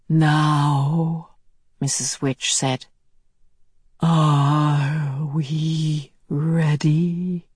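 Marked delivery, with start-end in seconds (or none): 0.1-1.3 distant, eerie timbre; a ghostly summons; otherworldly voice. Acted. Mrs Which speaks. 4.0-7.5 very slow, distant otherworldly voice; drawn-out ghostly words; eerie slow voice. Acted. Mrs Which speaks.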